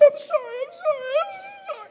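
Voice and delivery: squealed, very high imitated sobbing; crying out of control. Wife apologizing in her husband's arms, imitated uncontrolled crying. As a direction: wail the apology as if sobbing helplessly. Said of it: "She was crying out of control".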